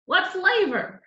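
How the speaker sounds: high, bright question; cheerful counterman. A narrator's reading. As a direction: ask it eagerly, high up. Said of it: "Mickey asked".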